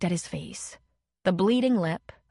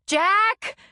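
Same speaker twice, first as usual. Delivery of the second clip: high piercing scream of his name; 13 semitones higher, 6 dB louder than usual. A narrator's reading. Leslie screaming at the men fighting, piercing scream. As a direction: shriek the name in alarm. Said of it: "Leslie screamed".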